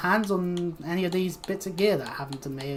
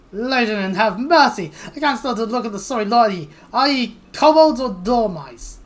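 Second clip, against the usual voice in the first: exasperated scolding; 4 semitones higher, 8 dB louder than usual. Acted. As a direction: berate them in acted exasperation. Exasperated character scolds cowardly kobolds.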